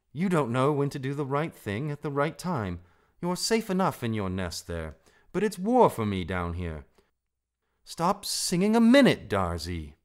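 scold, peaking on the order to stop; urgent, cross reprimand. A narrator's reading. Rikki scolds Darzee to stop.

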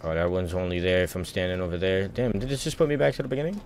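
normal talking voice

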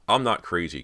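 loud, emphatic protest; insistent. A narrator's reading. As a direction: insist on being believed. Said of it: "Cody insisted".

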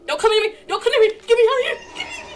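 high, loud terrified pleading; panicked yelling. Real, not acted. Player fleeing the creature, yelling in panic. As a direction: screams at it to keep away. Player begs monster to stay away.

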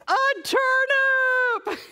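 very high, loud, stretched-out squeal; hammed-up storybook glee; overjoyed shout. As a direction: shout the big reveal with sheer delight. Cow's big reveal, overjoyed.

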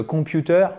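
computer said the French way, even syllables rising to the end, hard t; wrong French-style pronunciation. Shown as a demonstration. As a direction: demonstrate computer as a mistake with a French accent. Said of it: "Parce que si vous dites".

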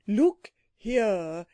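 mildly angry creature, drawn out; furious. A narrator's reading. Psammead to the children after landing home, mildly furious. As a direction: draw the words out in restrained indignation. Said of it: "The Psammead was furious".

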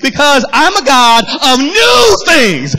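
climbing pulpit crescendo in God's words; full-force preaching. Acted. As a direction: preach it with rising pitch, stretching key words.

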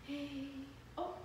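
ordinary talk

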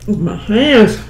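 talking while licking fingers. Real, not acted. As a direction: fingers in the mouth. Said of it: "I'm licking my fingers".

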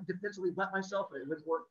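usual speaking voice

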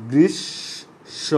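গ্রীষ্ম drawn out very slowly, the s hissed long before a rising end; stretched sibilant. Shown as a demonstration. Teacher stretches the sibilant of গ্রীষ্ম for his students. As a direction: hold the s of গ্রীষ্ম long and slow. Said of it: "তাহলে উচ্চারণটা তালব্য স হবে".

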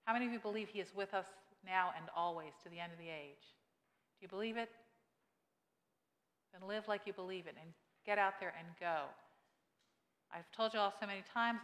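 ordinary talk

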